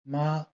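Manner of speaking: MA high and level; first tone. Shown as a demonstration. Teacher gives the word for mother, high level tone. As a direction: hold "Ma" high and even. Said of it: "haut et tenu".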